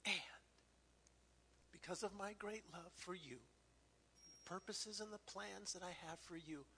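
soft, hushed words of comfort; tender whisper. Retold or imitated. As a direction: whisper it like a promise to a child. God's reassurance, tearfully retold.

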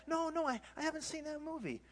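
high-pitched mock conversational voice; lightly acted high-pitched reply; imagined reply.

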